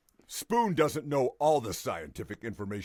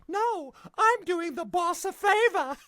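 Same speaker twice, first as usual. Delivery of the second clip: lady in the car. Retold or imitated. Lady caught in the car answers the officer, high put-on voice. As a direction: squeak it out like the caught woman. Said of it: "And she says".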